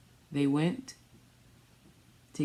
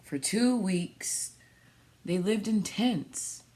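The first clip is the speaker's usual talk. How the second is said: storybook reading; 4 semitones higher than usual. Acted. Reads picture book aloud.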